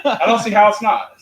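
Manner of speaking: loud, slow and insistent; emphatic. Real, not acted. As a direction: insist it loudly. Insisting it is magic.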